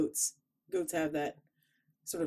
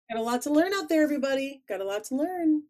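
raised pitch, preachy sign-off; high mock-wise voice; 7 semitones higher, 4 dB louder, faster than usual. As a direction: address everybody like a mock sage.

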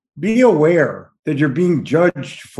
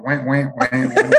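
dog noises. Retold or imitated. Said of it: "the dogs are going".

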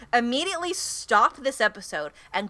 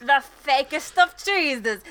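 bad Italian accent, slow; hammy fake Italian accent; 3 semitones higher, slower than usual. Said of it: "a terrible Italian accent".

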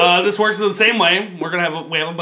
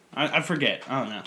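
normal talking voice

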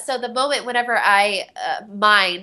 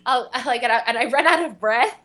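ordinary talk, nothing marked

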